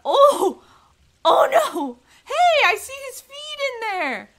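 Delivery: high, loud excited squeals. Real, not acted. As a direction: gush over the puppy. Excited at the dog's feet.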